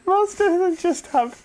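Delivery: giggling. Real, not acted. Giggling before explaining silly catchphrases.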